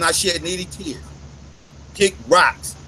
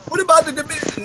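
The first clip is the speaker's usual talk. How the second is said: mock objector; 9 semitones higher, faster than usual. Mock question from imagined critic.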